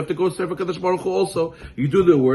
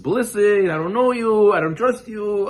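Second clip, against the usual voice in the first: higher, pointed voice of a suspicious seller; wary merchant; 6 semitones higher than usual. Retold or imitated. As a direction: play the seller who won't trust the buyer. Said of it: "He says".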